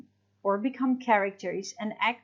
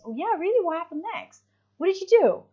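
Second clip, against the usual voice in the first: much higher, bright interested questions; eager questions; 8 semitones higher, 3 dB louder, faster than usual. Shown as a demonstration. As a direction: sound hooked on the story. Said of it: "you can also ask questions".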